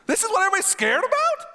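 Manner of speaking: high and fast, dismissing the giant; unimpressed David. Acted. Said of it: "he walks in, he sees God".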